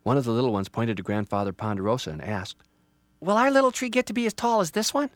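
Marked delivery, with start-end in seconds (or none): 3.2-5.1 put-on high, loud kid voice; curious child. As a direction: ask eagerly like a little one. Child asks parent.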